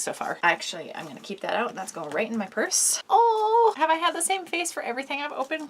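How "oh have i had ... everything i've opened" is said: excited. Real, not acted. Laughing at own face.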